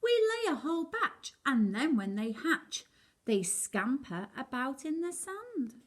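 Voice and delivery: high, bright little turtle voice; high chirpy sea turtle. Acted. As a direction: squeak it out like baby turtles.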